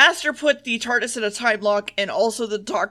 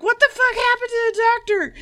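high, louder hammed-up freak-out; mock panicked yell; 12 semitones higher, 4 dB louder than usual. Joe demanding to know what happened to the Doctor, hammed-up high yell. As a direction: shriek it like a panicked sidekick.

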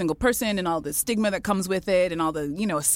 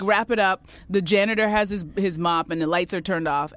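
ordinary talk, nothing marked

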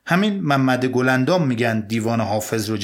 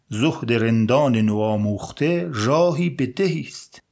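slow recitation. Shown as a demonstration.

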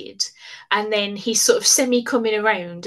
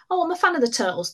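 mocking; 7 semitones higher, faster than usual. Mocking celebrity fans.

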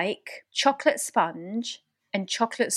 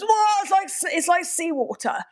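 shocked outsider; 9 semitones higher than usual. Retold or imitated. Mocking how newcomers first taste dour. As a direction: recoil at the salty taste. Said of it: "always goes".

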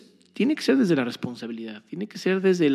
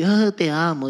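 mock lovey couple; 3 semitones higher, 5 dB louder, slower than usual. Mocking lovey young couples.

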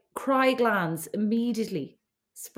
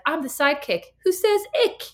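higher-pitched mock TV catchphrase; 6 semitones higher, 4 dB louder than usual. Host pitches her sister a TV catchphrase, hammed-up sidekick. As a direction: announce it like a sitcom sidekick's catchphrase. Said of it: "that can be your catchphrase".

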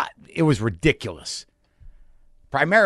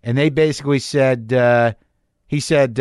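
ordinary talk, nothing marked

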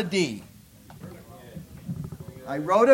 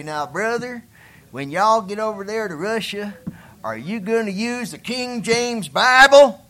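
hillbilly voice; 3 semitones higher, 7 dB louder than usual. Retold or imitated. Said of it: "this is my hillbilly ignorant voice".

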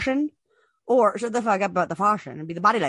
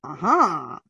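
low mock-attentive murmur with a swoop; imitating nodding streamer; 6 semitones lower than usual. Host mimicking streamer's only reaction, mock attentive. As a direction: put on a low, absorbed acknowledgement. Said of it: "him just going".

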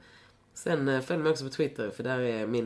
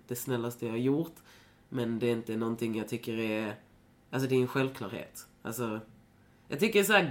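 normal talking voice

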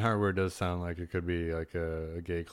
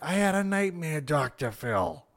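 Bernie; 8 semitones higher, 3 dB quieter than usual. Retold or imitated. Commercial line popping up while driving, high mock yelling. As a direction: replay the commercial cry, stretching the ending. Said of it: "just yelling at his, his wife".

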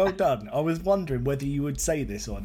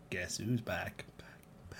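whispering; 5 semitones lower, 11 dB quieter, much slower than usual. Pretend. [name] lyric whispered intro.

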